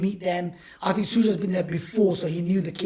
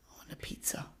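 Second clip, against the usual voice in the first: whispered; 19 dB quieter than usual. Retold or imitated. Orphan girl's wish, retold.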